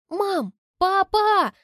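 high, loud call through the house. Acted. Daughter calls her parents from the hall, loud and high. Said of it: "Зову их".

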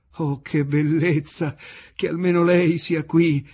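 put-on high, loud old waiter's squeal of joy; overjoyed old waiter's shriek; shrill old waiter. A narrator's reading. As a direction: squeal with delight like an old waiter, slow at first. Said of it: "strillò".

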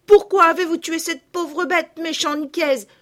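high, loud indignant reproach. A narrator's reading. Girl reproaching the gamekeeper, indignant and loud. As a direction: let the outrage burst out. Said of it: "dit Camille avec indignation".

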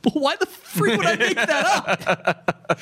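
high-pitched incredulous laughing outburst; drawn-out, squeaky laughing indignation; laughing protest. Retold or imitated. Mock indignant outburst while laughing.

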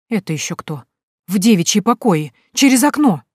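startle, then bristle; alarmed outrage. Acted. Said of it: "всполошилась". (всполошившись, возмущённо)

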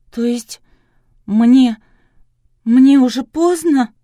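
high, loud, halting question; distraught. Acted. Patient asks whether it is too late, alarmed and loud. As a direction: break the question up in rising panic. Patient hears treatment may fail.

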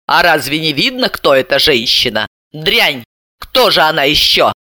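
ordinary delivery

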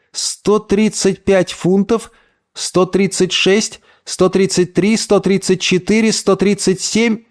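high, slow, suspended count; wondering read-out. Acted. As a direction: read each number out in turn, leaving it hanging. Reading out weights in wonder.

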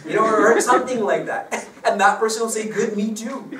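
high, loud words breaking into laughter; laughing through words. Real, not acted. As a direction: let the laugh carry the punchline. Laughing at his own joke.

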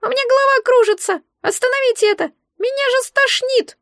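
very high, panicky little creature; hysterical squeaky voice. A narrator's reading. The egg begs to stop being spun, hysterical squeak. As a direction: squeal in dizzy protest. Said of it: "раздался истеричный писк".

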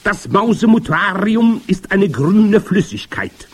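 high, loud witch declaiming; imperious high-pitched witch voice; grand witch. A narrator's reading. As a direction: proclaim the magic plan to your followers. Grand witch explaining her potion.

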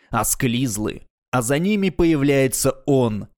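plain reading voice

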